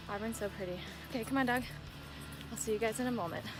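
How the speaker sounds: ordinary talk, nothing marked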